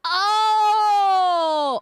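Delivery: long, high, loud "oh"; drawn-out exclamation of sudden understanding; loud realization cry. Real, not acted. Streamer finally getting 3D art, loud realization cry.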